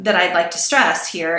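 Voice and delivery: ordinary talk, nothing marked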